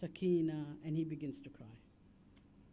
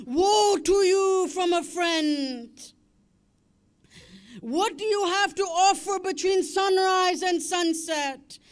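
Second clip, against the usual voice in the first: much louder and higher, shouted through sobs; wailing lament; 9 semitones higher, 13 dB louder than usual. Acted. Preacher voicing the women's cries over Hussein, sobbing wail.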